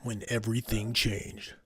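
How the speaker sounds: measured mock trailer narrator, slowing at the end; movie trailer voice. Host correcting his made-up trailer, mock trailer narration. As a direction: tease a film with a portentous pause. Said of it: "it's usually like a, a first name, last name".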